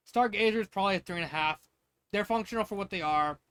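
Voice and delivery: normal talking voice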